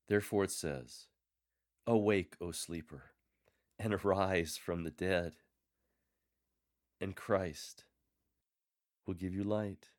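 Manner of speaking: measured scripture proclamation with a call; scripture reading. Shown as a demonstration. Lector modelling the closing hymn verse, measured. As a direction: lift "Awake" then settle into the promise.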